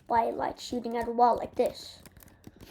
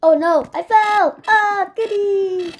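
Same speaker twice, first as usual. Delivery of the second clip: hammy dramatic cry; 5 semitones higher, 9 dB louder, slower than usual. Acted. Hammed fall in game.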